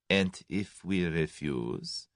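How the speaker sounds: soft and slow, cold question; the German's quiet, slow threat to Tommy; quietly. A narrator's reading. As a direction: ask it quietly and very slowly. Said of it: "said the German quietly".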